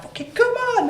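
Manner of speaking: high, fired-up rallying cry; mock pumped-up yell. Boasting to the podcast hosts, a high rallying cry. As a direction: psych yourself up for the deal.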